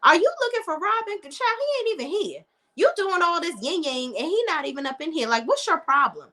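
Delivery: high-pitched sassy receptionist voice; imitating a nosy coworker, high and sassy. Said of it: "She's like".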